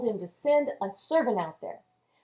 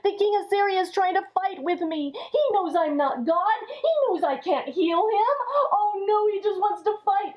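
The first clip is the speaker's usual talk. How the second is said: higher, near-tearful panic; mock frightened wail, higher; scared king; 7 semitones higher than usual. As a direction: fret aloud, a whimper breaking through. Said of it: "And he was so scared".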